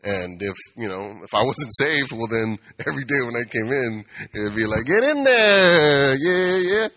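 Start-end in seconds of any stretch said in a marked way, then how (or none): 4.8-6.9 high, loud, very slow taunting; drawn-out playful taunt; mock gleeful teasing. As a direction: gloat while going in for the tickle. Imagined tickling attack, gleeful.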